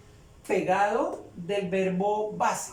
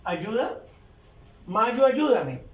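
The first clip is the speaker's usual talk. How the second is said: mock plea; 2 semitones higher, 4 dB louder, slower than usual. Shown as a demonstration. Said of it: "Pues no tiene sentido".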